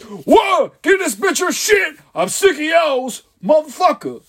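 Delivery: high-pitched comic outburst; exasperated high character yell; mock yelling. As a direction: yell it high, like a fed-up heckler. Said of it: "he always be yelling".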